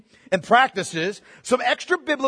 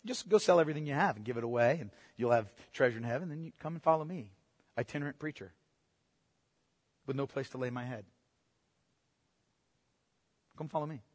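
much softer and lower, a hushed, tender call; gentle invitation; 9 semitones lower, 11 dB quieter, faster than usual. Retold or imitated. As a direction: say it quietly, like an invitation to follow. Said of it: "Jesus says to him".

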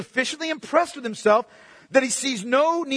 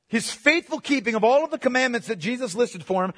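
ordinary talk, nothing marked

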